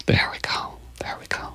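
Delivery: very soft unvoiced whisper; hushed whisper. Real, not acted. Storyteller closing the tale to listeners, hushed whisper. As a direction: whisper it, fading almost to nothing. Teller murmurs at story's close.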